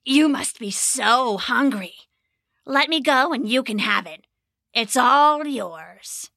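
slow, sly tempting goblin voice; coaxing goblin. A narrator's reading. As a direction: dangle the bait sweetly.

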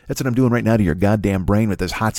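ordinary talk, nothing marked

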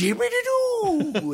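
very high, slow silly tag; goofy sing-song. Retold or imitated. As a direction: chirp it like a cartoon. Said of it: "is what he said afterwards".

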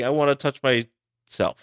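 usual speaking voice

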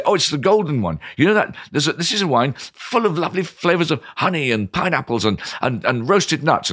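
high, eager wine pitch; enthusiastic selling. Acted. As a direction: sell it with relish. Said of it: "And I'll say".

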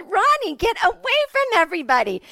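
high, loud mock shout; mom yelling. Mom yelling at dad in the grocery store, exasperated. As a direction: holler it like an exasperated wife. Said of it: "Of course I was yelling".

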